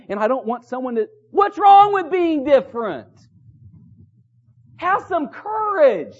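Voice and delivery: high, impassioned pulpit challenge; forceful preaching. Acted. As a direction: press the congregation hard.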